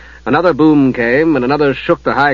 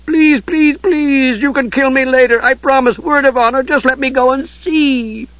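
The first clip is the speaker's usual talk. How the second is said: begging kender; 12 semitones higher than usual. Acted. Kender begging his captor to let him see. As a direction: beg to be let go. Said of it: "the Kender begged".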